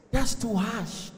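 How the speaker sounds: drawn-out mock reproach; mock offended. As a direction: stretch it out, wounded by the accusation. Man wounded by word "lied.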